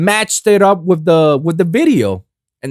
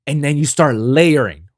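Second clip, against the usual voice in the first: ordinary talk, nothing marked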